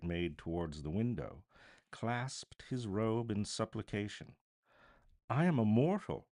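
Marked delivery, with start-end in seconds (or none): 5.3-6.3 pleading protest, raised; remonstrating. A narrator's reading. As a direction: push back, begging to be spared. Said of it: "Scrooge remonstrated".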